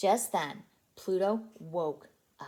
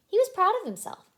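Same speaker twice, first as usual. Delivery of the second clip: proud; 10 semitones higher, 8 dB louder than usual. A narrator's reading. Narrator shows pride.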